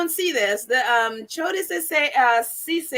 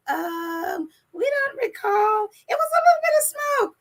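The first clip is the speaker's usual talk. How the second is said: high-pitched mocking imitation of excuse-makers; put-on high voice, sending them up; 8 semitones higher than usual. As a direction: squeak out a feeble excuse, mockingly. Mimicking the royals' feeble excuse.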